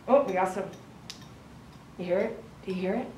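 ordinary talk

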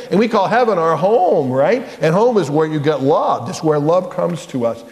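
usual speaking voice

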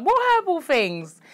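high, drawn-out disbelief; retold incredulous outburst, pitch shooting up; incredulous question. Questioning little sister about herbal things, incredulous. Retelling her alarmed reply to sister.

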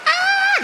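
very high shocked shriek of a posh lady. Retold or imitated. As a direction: let out a piercing scandalized scream. Horrified lady screams. (grito agudo escandalizado)

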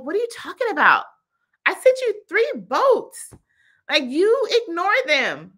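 high, loud mock disbelief; indignant God. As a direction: scold in exasperation, stretching the key words. Voices God answering the man indignantly.

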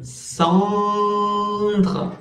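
the "en" of "cendre" said as a drawn-out nasal sound; teacher models the nasal vowel in "cendre". Shown as a demonstration. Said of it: "c'est un".

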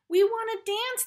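put-on high, loud children's cry; excited cry. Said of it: "the twins cried".

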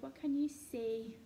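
ordinary talk

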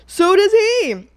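high, slow whiny mock voice; mocking imitation. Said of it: "He's just like".

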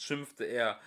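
ordinary delivery

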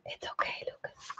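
a hushed reassurance to Lucas; whispering so the viewers barely hear; whispered aside. Real, not acted. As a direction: whisper softly to the cameraman off-mic. Aside to cameraman during live.

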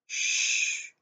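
long breathy warning "shh"; whispered shush. A narrator's reading. Boy hushes the man to keep his secret, whispered shush. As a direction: hush him with a drawn-out hiss of air. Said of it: "he said in a loud whisper".

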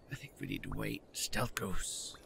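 very soft, low sneaky voice; low, barely voiced murmur; stealthy hush. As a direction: keep it hushed, as if sneaking. Sneaking as the goose in game.

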